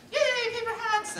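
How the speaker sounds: hammed-up childish glee; mock childish cheer. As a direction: sound like a delighted five-year-old. Mocking kindergarten class excitement.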